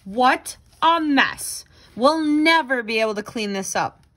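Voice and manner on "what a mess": ordinary delivery, nothing marked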